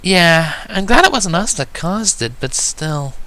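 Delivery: put-on high, young filly asking curiously; a little filly asking her sister a question; Sweetie Belle's voice. Young filly asking her sister, high and curious.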